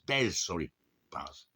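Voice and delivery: ordinary delivery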